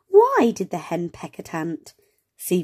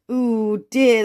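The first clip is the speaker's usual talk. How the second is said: ordinary talk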